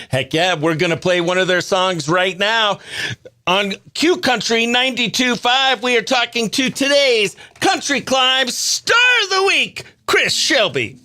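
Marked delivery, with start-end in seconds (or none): 3.4-11.0 big radio ID, loud and higher; radio announcer. Acted. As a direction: announce it like on air. Radio host station ID.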